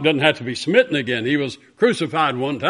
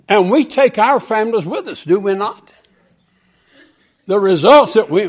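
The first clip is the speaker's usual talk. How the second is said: loud, high pulpit preaching building to a crash; full-force revival sermon; fervent preaching; 9 semitones higher, 7 dB louder than usual. Acted. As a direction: bring the story to a shouted boom.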